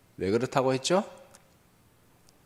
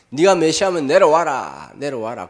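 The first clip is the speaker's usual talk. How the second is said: loud, higher mocking yell, fading; shouting; 6 semitones higher, 11 dB louder than usual. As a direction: yell the dare up at him. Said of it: "소리소리 지릅니다".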